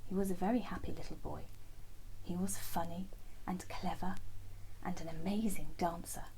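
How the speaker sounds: ordinary delivery, nothing marked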